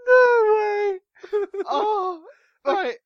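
high, loud squeal through laughter; hysterical laughter. Real, not acted.

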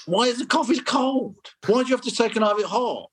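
high, incredulous complaint; mock indignation. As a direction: whine about the cold coffee. Mock outraged complaint about coffee.

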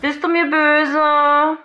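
slow, high, pleading. Acted. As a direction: ask it like a guilty child. Asking if friend is upset.

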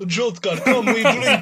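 booming Eastern European toast; loud, hammed-up Eastern European accent. Podcast host showing how Jodka should sound, Eastern European accent. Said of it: "in an Eastern European accent".

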